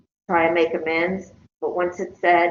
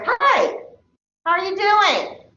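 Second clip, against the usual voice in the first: bright high-pitched hallway hello, much higher than usual; demonstrated friendly hello, pitched way up; friendly passing greeting; 11 semitones higher than usual. Shown as a demonstration. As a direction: sing out a cheery greeting to someone you know. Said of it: "My phatic norm will be to say".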